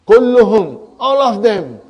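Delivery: "Kulluhum" and "all of them" punched loud and high; emphatic. Shown as a demonstration. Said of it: "Now I want to emphasize".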